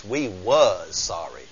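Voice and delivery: "was" drawn out slow and low; Southern emphatic grammar. Shown as a demonstration. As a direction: drag out "was" in a deep, soft drawl. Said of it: "As you say in the South".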